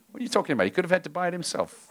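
a hint of a sulky boy arguing back; mock indignant kid. Preacher voicing his son's protest, lightly mocking.

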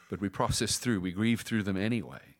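normal talking voice

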